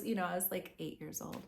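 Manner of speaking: usual speaking voice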